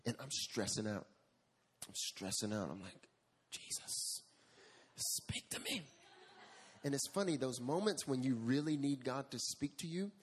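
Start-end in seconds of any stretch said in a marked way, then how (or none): 3.5-5.8 a hushed, breathy plea to Jesus; whispered prayer. Retold or imitated. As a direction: whisper it like a desperate prayer alone in the room. Desperate prayer at the computer.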